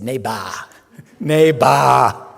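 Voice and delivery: put-on "Neighbor" drawn out slowly in a thick Austrian accent, breaking into a laugh between the two; a laughing, slowed imitation of Arnold's Austrian "Neighbor"; Arnold saying neighbor. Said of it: "he couldn't say neighbor".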